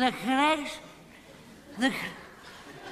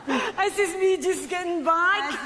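put-on laughing drunkenly; 6 semitones higher than usual. Drunk woman joking about her lunch.

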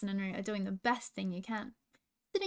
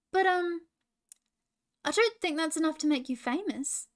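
higher-pitched, hesitant objection; doubtful; 5 semitones higher, 4 dB louder than usual. A narrator's reading. Roo questions Ferguson's portrait scheme, doubtful. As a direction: gently cast doubt on a friend's plan.

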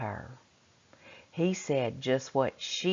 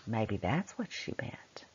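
whisper; 6 semitones lower, 7 dB quieter than usual. Real, not acted. Teacher muses on meaning.

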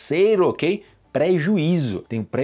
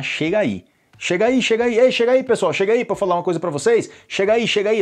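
loud, higher call to gather the group; calling people over; 6 semitones higher, 3 dB louder than usual. Acted. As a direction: call everyone over across the room. (chamando o pessoal em voz alta)

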